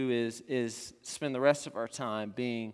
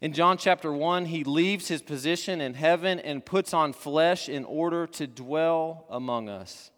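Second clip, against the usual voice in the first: normal talking voice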